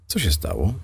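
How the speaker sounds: a touch of just-woken grogginess; sleepy voice. Acted. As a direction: ask as if half asleep. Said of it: "zapytała zaspanym głosem".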